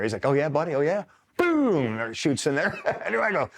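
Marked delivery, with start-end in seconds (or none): 0.2-1.0 imitated eagerness. Acts out buddy's eagerness to shoot. 1.4-2.0 high-pitched quick "boom"; imitated blast.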